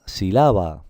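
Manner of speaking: "Sílaba" stressed in the middle, si-LÁ-ba; wrong penultimate stress. Shown as a demonstration. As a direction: punch LA in "Sílaba", si-LÁ-ba. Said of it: "Tampoco suena bien". ("Sílaba" mal acentuada en la penúltima: si-LÁ-ba)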